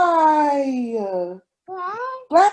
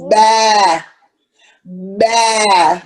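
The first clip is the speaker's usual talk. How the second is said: louder, lower "bah" bleats; sheep bleat; 4 semitones lower, 5 dB louder than usual. Pretend. Mom showing her toddler what a sheep says, bleating.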